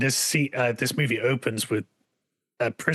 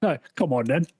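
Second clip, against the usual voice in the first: casual. Retold or imitated. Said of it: "It was so casual".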